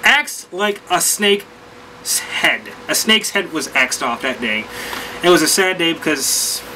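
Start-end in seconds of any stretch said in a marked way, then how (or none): 0.0-2.6 slow dramatic. Real, not acted. Dramatic joke about the axed plan.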